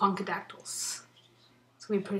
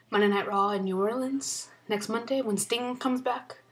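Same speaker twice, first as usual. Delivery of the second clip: ordinary delivery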